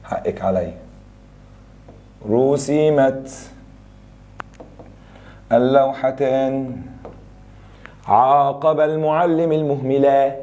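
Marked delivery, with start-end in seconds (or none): 2.3-10.3 رسمت drawn out very slowly as rusimat; slow dictation. Shown as a demonstration. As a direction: spells out رسمت for the class. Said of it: "دي المفروض تبقى رسمت". (رسمت ببطء شديد للإملاء)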